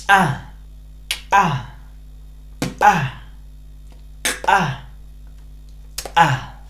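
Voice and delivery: each "a" opened with a sharp glottal pop, like a small explosion; glottal consonants. Shown as a demonstration. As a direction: let each "a" burst from the throat. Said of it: "como si fuera una explosión". (consonantes glotalizadas con explosión)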